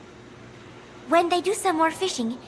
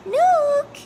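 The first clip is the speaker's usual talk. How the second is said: high, louder call for the cat; 12 semitones higher, 7 dB louder than usual. Acted. High loud call to the cat Nook. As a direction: call the cat from across the room.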